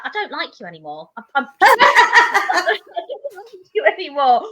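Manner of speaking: half-laughing, half-crying complaint; laughing quote. Retold or imitated. Reader texting the author after the ending, laughing. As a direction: squeal it out through laughter. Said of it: "she was like".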